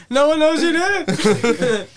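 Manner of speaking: laughing. Real, not acted. Laughing through a joke.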